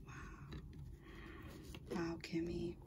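soft whispered wow; whispered amazement at the card. Real, not acted. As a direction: breathe it in quiet awe. Reacting to the card.

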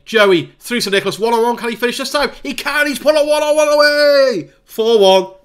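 climbing from eager to a loud, high shout of triumph; real live joy at a late goal; building goal call. Real, not acted.

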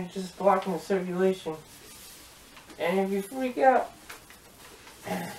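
speaking through a face mask; muffled inside closed helmet. Real, not acted. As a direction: voice trapped behind faceplate. Seen 4: Faceplate stays closed over his face through all labelled words.

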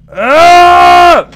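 loud high startled scream. Real, not acted. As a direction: shriek in real fright. Scream at game scare.

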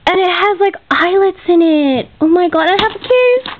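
usual speaking voice

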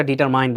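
ডিটারমাইন ending like "mine"; common mispronunciation. Shown as a demonstration. Teacher quoting what many people say, mispronounced. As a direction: say ডিটারমাইন as "di-tar-main". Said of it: "আমরা অনেকেই কিন্তু এটা".

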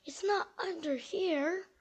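ordinary delivery

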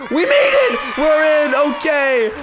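loud, high-pitched cheer; triumphant shout. Real, not acted. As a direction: shout it out in victory. Celebrates getting into secret room.

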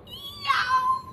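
put-on shrill high yell, storybook boy; a sudden high "Yow!"; high-pitched yelp of pain. A narrator's reading. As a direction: yelp from biting on a sore tooth. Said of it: "took a bite and yelled".

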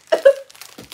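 shrieking high laugh; loud laugh. Real, not acted. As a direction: crack up loudly. Laughs hard.

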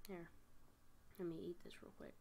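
soft voiceless aside; whisper. Real, not acted. Gamer aside to viewers while eating, whispered. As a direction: murmur it under your breath.

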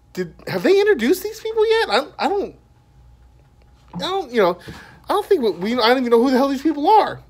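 high-pitched, bewildered questioning; retold bewildered asking. Asking a friend watching the movie with him, bewildered.

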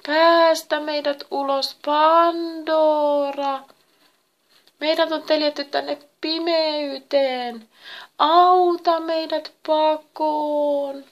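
high, loud, drawn-out wail; pleading voices. A narrator's reading. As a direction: beg for release in long, stretched tones. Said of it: "äänet anelivat". (anelevat, valittavat äänet)

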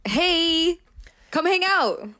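high, singsong call-out; calling out invitingly. Pretend.

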